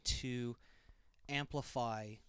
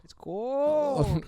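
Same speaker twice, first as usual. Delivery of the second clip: high, loud, drawn-out GOLD; big stretched-out hype call; playful exclamation; 11 semitones higher, 9 dB louder than usual. Pretend. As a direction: belt it out with mock awe. Playful exclamation about gold ball.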